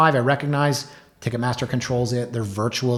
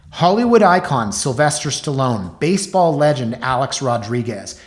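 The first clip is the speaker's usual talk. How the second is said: ad read; 6 dB louder than usual. Acted. Host reading magazine ad for the expo, hyped announcer style. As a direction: hype it up like an expo promo.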